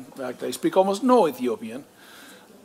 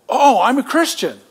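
higher and louder, a glad burst on Oh; eager relief; 8 semitones higher, 6 dB louder than usual. Retold or imitated. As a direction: voice the daughter's happy surprise. Daughter's relieved reply retold.

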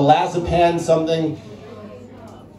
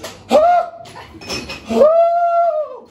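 imitated shower gasps. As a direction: gasp like someone hit by ice-cold water. Imitates Louise heard in shower.

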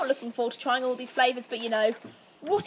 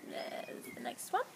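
whispering; 14 dB quieter, slower than usual. Real, not acted. Whispered, chewing.

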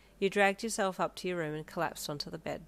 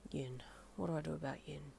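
whispered, troubled thought; whispered groan; 3 semitones lower, 10 dB quieter than usual. A narrator's reading. Darby alone in hospital, whispering his worry. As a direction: breathe it out in despair. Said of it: "he thought with a groan".

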